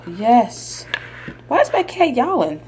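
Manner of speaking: usual speaking voice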